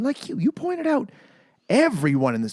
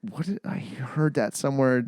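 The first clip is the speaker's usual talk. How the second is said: usual speaking voice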